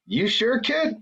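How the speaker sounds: put-on incredulous high-pitched taunt, teasing the kid; imitating Mad Mel. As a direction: skeptical veteran sizing up a baby-faced rookie. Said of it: "he goes".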